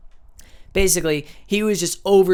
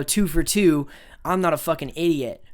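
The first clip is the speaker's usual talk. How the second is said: ordinary delivery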